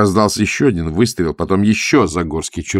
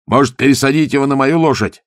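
raised, higher riding shout; calling out on horseback; 7 semitones higher, 5 dB louder than usual. A narrator's reading. Gonzalin offers his horse mid-gallop, calling out. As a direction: call it over to a fellow rider mid-gallop. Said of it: "на скаку крикнул Гонзалин".

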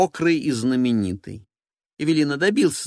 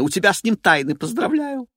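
sarcastic diva voice; 8 semitones higher, 4 dB louder than usual. Acted. Evelina voiced.

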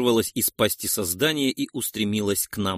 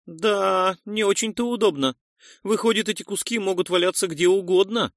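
higher-pitched character voice, mildly troubled; frowning concern; 7 semitones higher than usual. A narrator's reading. Noise reasons about scattered relay parts, frowning concern. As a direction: think it through in a raised voice. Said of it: "нахмурился Нойс".

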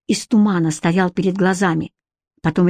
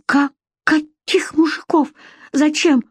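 higher, slow, dumbfounded stammer; stunned; 7 semitones higher than usual. A narrator's reading. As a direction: stammer it in disbelief. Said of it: "обалдела пробормотала Вика".

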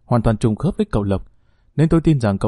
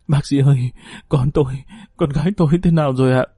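urgent, hurried plea close to sobbing; tearful pleading; 2 semitones higher, faster than usual. A narrator's reading. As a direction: plead with the doctor as if crying. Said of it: "rồi khóc nức nở". (van hỏi nghẹn ngào như sắp khóc)

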